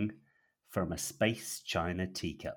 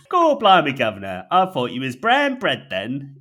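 loud, higher Cockney exclamation; Cockney interjection; 6 semitones higher, 12 dB louder than usual. Acted. Pooper scooper interjecting, loud Cockney. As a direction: blurt it like a London barrow boy. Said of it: "interjects the pooper scooper".

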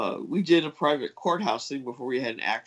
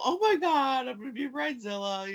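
mock gushing bride; 9 semitones higher than usual. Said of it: "I didn't do the big female".

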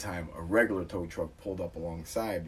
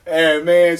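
ordinary delivery

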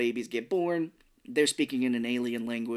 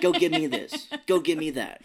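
nagging mom; 7 semitones higher, 5 dB louder than usual. Retold or imitated. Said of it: "Milo's mom's like".

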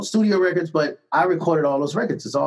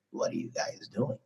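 barely audible breathy whisper, very soft; 13 dB quieter than usual. Retold or imitated. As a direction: mimic a man who hardly raises his voice. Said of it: "That's as loud as he spoke".

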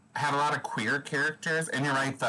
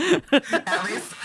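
squeaky exclaim; 7 dB louder than usual. Real, not acted. Sudden squeal.

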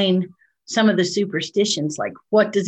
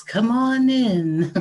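creepy invitation; 3 semitones higher, much slower than usual. Acted. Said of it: "and then you hear".

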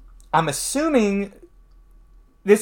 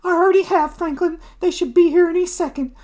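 very high lady's voice; Debbie upset; 5 dB louder, faster than usual. Acted. Hostess telling Franklin police are coming, high and upset. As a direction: answer in a high, wailing hostess voice. Voicing Debbie answering Foggy.

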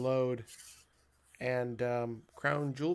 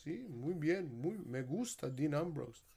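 Spanish voice; 13 dB louder than usual. Acted. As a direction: play an eager local answering in Spanish-accented lilt. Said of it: "when I do that Spanish voice".

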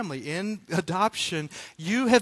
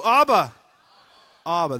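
with gusto; 11 dB louder than usual. Shown as a demonstration. Preacher leads congregation in repeating the word, with gusto. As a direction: belt it out with gusto. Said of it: "say it again with more gusto".

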